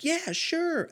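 high chirpy mock-excited Yeah, sure; mock perky text. Mocking how people text with exclamation points, high mock cheer. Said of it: "exclamation point".

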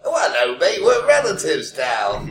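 chirpy mock-British greeting, rising high; exaggerated British accent, slowing at the end. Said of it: "whole bunch of British family".

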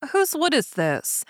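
haughty princess. A narrator's reading. Princess asking the fiddler whose wood, haughty and high.

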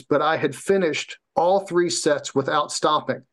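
ordinary delivery

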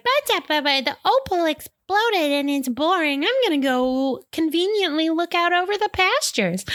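high-pitched, mock complaining voice; bored kid voice. Retelling Jem getting bored, high mock complaint.

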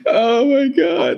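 very high, very slow words squeezed through laughing; helpless laughter. Real, not acted. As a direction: let it crack out through a laughing fit. Laughing at the "on set" line.

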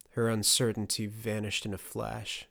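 usual speaking voice

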